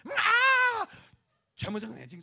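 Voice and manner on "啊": put-on very loud high scream of a child waking; sudden piercing shout; a revived child's loud cry. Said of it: "他们听一个最喜欢听的声音".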